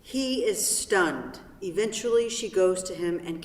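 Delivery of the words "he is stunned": normal talking voice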